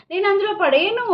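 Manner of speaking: a mother mimicking her small son digging in his heels; stubborn little son. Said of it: "అంటాడు".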